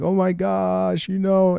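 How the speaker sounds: high, slow whimpering imitation of a crying girl; hammed-up fake sob; mock crying. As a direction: imitate her teary wail, drawn out. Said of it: "she's over there crying like".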